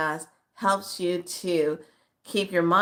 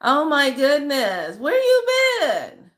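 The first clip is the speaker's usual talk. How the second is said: high, slow, louder delighted call; happy surprise; 8 semitones higher, 6 dB louder, slower than usual. Real, not acted. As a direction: stretch it out with glad surprise. Surprise at seeing the follower.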